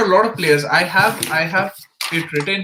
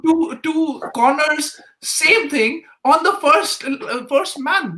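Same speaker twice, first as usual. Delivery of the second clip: heated, higher-pitched exasperation; fired up; 7 semitones higher than usual. Real, not acted. Panelist ranting about a player's poor corners, fired up. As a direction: argue the point, worked up and higher. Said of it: "we're getting a little fired up here".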